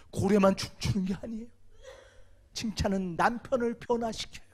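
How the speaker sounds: ordinary delivery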